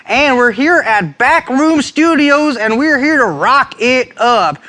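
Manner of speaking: high, drawn-out, hollering show opener; mock over-excited TV host, loud and stretched; hammed-up host voice. Host welcoming viewers to Rock It Up, hammed-up. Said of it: "why am I talking like this?".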